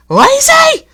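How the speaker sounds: very high, loud falling Lazy?; outraged echo. A narrator's reading. Coaches offended, repeating.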